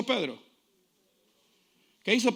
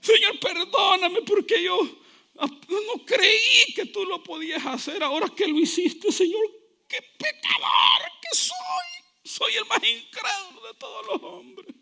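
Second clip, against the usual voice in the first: high, wailing, tearful plea; mock weeping; 12 semitones higher, slower than usual. Believer begging the Lord for forgiveness, high tearful wail. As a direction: whimper and wail like a sinner begging. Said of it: "Yo no quiero venir llorando".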